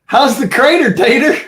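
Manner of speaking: high, loud teasing sing-song; squeaky gleeful jab; mock taunt. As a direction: gloat at the neighbor in a high mocking voice. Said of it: "I'm gonna be like".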